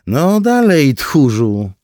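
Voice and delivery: high, loud jeer at an opponent; taunting call. A narrator's reading. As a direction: call out loudly, goading a coward. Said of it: "zawołała". (zaczepne, głośne zawołanie)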